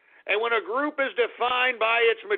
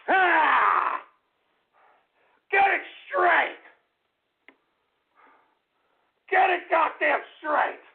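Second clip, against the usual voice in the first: raging tantrum; 6 semitones higher, slower than usual. Acted. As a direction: yell like a boss gone over the edge.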